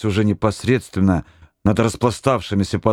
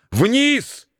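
high desperate yell; desperate shout. A narrator's reading. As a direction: cry out in panic. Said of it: "отчаянно закричал Горелов".

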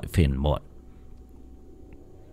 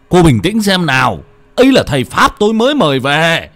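higher and louder stern rebuke; half shouting; 7 semitones higher, 7 dB louder than usual. Acted. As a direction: shout it into her face. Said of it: "nói như hét vào mặt".

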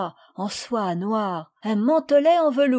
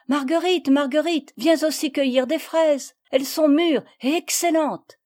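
put-on higher, louder child voice calling; 6 semitones higher, 4 dB louder than usual. A narrator's reading. Camille calls Marguerite to pick strawberries, calling out. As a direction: call a friend over to share. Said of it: "appela Marguerite".

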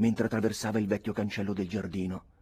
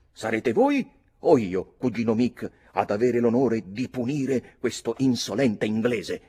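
put-on proud young challenger; 4 semitones higher, 8 dB louder than usual. Redmond offering his cousin the duel, proud and loud. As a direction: puff up and offer the honour grandly.

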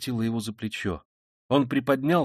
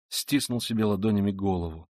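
plain reading voice

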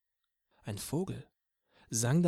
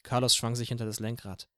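plain reading voice

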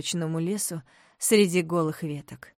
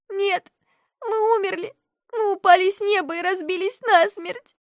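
high, loud desperate cry; distraught girl; 13 semitones higher, 5 dB louder than usual. A narrator's reading. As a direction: sob out that they're dead. Crying girl insists they died.

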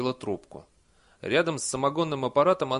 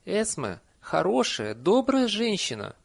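high, slow, emphatic pronouncement; firm declaration; 9 semitones higher, 4 dB louder, slower than usual. A narrator's reading. Nanny vouches for Esme to villagers, emphatic. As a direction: declare it word by word. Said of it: "объявила нянюшка".

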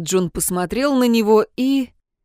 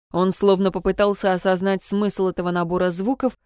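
ordinary delivery

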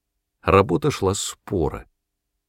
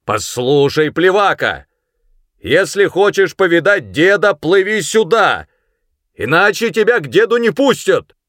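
loud, raised-pitch persuading shout; calling across distance; 9 semitones higher, 6 dB louder than usual. A narrator's reading. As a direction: shout so it carries over the river. Sergeant calls across the river. (громко кричит издалека, уговаривая)

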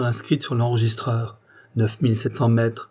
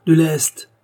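higher, louder desperate cry for ballast; frantic shout for more ballast; urgent shout; 5 semitones higher, 7 dB louder than usual. Acted. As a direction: yell it out as the balloon falls.

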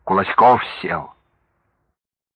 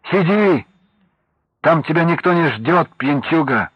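shouting from the kitchen; 6 semitones higher than usual. Acted. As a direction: yell it across the house, scolding. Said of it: "оттуда крикнула".

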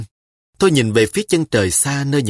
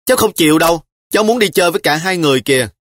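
higher, louder, fast whine; a little girl refusing to give in; whining child; 6 semitones higher, 4 dB louder, faster than usual. A narrator's reading. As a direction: squirm and whine like a spoiled child. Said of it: "Trà Long nguấn ngoậy".

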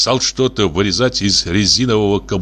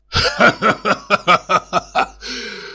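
deafening guffaw; 10 semitones higher than usual. Acted. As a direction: roar with laughter. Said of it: "оглушительно расхохотался".

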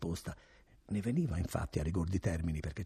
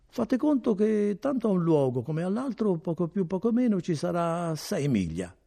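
higher, louder innkeeper explaining; lightly voiced innkeeper; 11 semitones higher, 8 dB louder than usual. A narrator's reading. Innkeeper smoothing over his question to Renzo, lightly voiced. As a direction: cover the nosy question with bluster.

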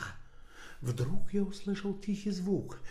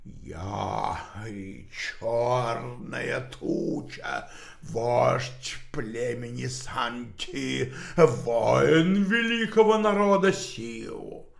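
very slow, low spirit voice; otherworldly voice; 5 semitones lower, 5 dB louder, much slower than usual. Acted. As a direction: intone it like an ancient spirit chief. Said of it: "потусторонний голос ответил".

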